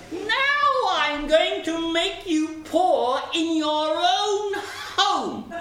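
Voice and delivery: high, slow, stretched threat; menacing sparrow. A narrator's reading. Sparrow vowing to ruin wagoner at home, menacing. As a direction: draw out the vow in a high bird voice.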